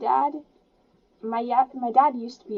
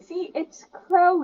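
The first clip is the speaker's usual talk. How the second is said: high, slow delighted reveal; excited; 8 semitones higher, 4 dB louder, slower than usual. Real, not acted. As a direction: point him out with glee. Excited to recognise character.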